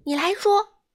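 high, loud call. A narrator's reading. Badou telling Dudu he's it, high calling voice. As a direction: call out who is it. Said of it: "巴豆喊道".

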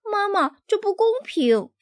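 put-on high whiny child voice; whining cub. A narrator's reading. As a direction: complain like a little kid. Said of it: "孩子们叽叽喳喳地抱怨起来".

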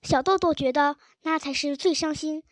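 ordinary delivery, nothing marked

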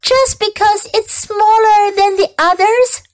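put-on upset child pleading high; shrieking. A narrator's reading. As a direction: protest at the top of her voice. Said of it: "shrieked Fern".

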